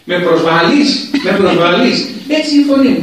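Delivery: loud, offended mock protest climbing high; imitated shouting. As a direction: act out an insulted arguer yelling back. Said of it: "μη φωνάζεις".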